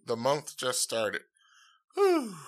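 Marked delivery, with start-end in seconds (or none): 2.0-2.4 high exclaimed whew. Real, not acted. Host marvels at how long January feels, exclaimed. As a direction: blow out a high amazed whew.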